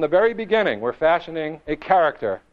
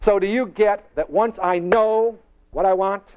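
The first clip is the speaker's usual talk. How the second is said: ordinary talk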